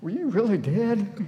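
high, drawn-out incredulous question; astonished mother. Acted. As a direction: play an amazed mom, slowly and high. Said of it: "when the mom goes home and their son".

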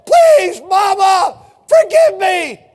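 high, loud begging wail; tearful plea. Retold or imitated. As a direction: cry out for forgiveness. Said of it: "tears were streaming down".

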